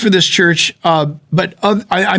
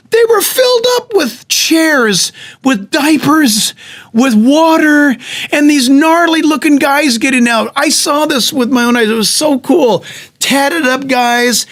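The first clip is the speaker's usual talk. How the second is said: excited; 6 semitones higher than usual. Real, not acted. Preacher telling the church about the trucks, thrilled and high. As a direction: let the excitement lift the voice high.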